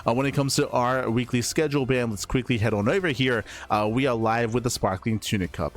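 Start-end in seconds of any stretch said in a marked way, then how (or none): none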